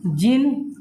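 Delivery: word by word with long pauses; teacher dictating word by word. Acted. Dictating the definition slowly.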